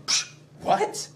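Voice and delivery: breathy scoffing brush-off; dismissive scoff. Acted. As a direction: brush the very idea aside. Lady Macbeth dismisses failure.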